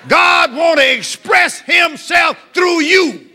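high, forceful preacher's declaration; full-force preaching. Real, not acted. Preacher urging the congregation to state their wants, full force. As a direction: hammer each phrase out to the congregation.

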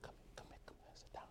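hushed whispered call. Shown as a demonstration. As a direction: whisper it gently, come sit. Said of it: "Same words. Different tone".

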